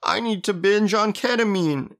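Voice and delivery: high, drawn-out fake sob story; mock boo-hoo whining. Host mocking stressed cashiers on ketamine, in a high whiny boo-hoo. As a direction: whine it slowly, mocking their self-pity. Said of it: "It's like a boo-hoo".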